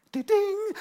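very high bright "Ding!"; ping it like a chime going off; imitated app ding. Imitating Duolingo's reward chime, very high and loud. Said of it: "it makes the sound".

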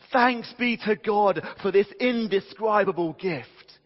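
high, loud, slow declaration of praise; ringing thanks, drawn out; exultant proclamation. Acted. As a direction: proclaim it jubilantly to the whole church. Voices Paul's exultant thanks.

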